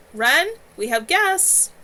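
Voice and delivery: loud, slow call through the house; yell. A narrator's reading. Floria yelling to Wren down the hall, loud. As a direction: call out to someone in another room. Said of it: "moved farther into the house to yell".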